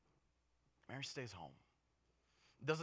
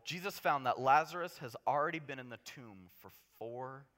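ordinary delivery

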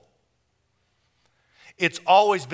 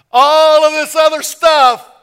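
much higher and louder; God shouts his generosity; sudden shout; 11 semitones higher, 16 dB louder than usual. Retold or imitated. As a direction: burst out. Sudden burst.

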